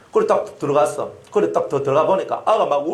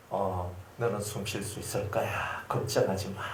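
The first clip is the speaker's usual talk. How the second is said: soft, slow, gentle comfort; calm a scared child; reassuring; 8 semitones lower, 12 dB quieter than usual. Retold or imitated.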